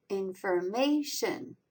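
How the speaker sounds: "Information" slowly, lighter stress on in, strongest on ma; slow IN-for-MAY-tion for "Information". Shown as a demonstration. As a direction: enunciate "Information" with the third syllable heaviest. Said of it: "In also has a lighter accent mark".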